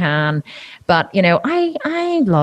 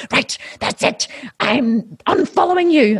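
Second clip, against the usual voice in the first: half-whispered huff, then a higher indignant snap; mock huffy troll; 8 semitones higher, slower than usual. Mimicking an angry vegan follower who quits, huffy. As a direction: imitate an offended follower flouncing off. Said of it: "Then they get so angry".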